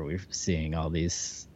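ordinary talk, nothing marked

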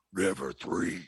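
deep, growled demon voice; lightly growled demon line. Shown as a demonstration. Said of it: "I needed to get, like, the demon voice".

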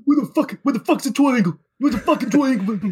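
put-on high, frantic old man hunting for a key; old Roy Hodgson. Shown as a demonstration. Host demoing the Roy voice for a contestant, frantic and high. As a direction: play a flustered old man searching. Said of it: "Along like that".

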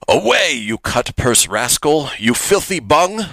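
put-on slow, theatrical British accent. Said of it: "I'm gonna do it in my best British accent".

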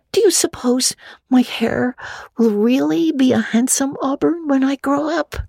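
put-on high, quick young girl voice; a chattering imaginative orphan girl; Anne, girlish and eager. Anne complains to Marilla, high girlish voice. Anne's voice continues.

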